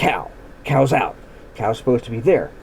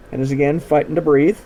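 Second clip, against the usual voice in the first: usual speaking voice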